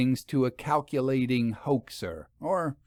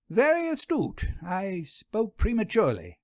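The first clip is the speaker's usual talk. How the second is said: much higher, arch and amused; wry concession; 8 semitones higher than usual. A narrator's reading. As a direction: concede with a lofty wry lilt. Vera concedes.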